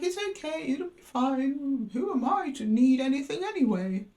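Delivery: high, small put-on voice; mock self-effacing. Scorpio brushing off their own needs, high mock-meek voice.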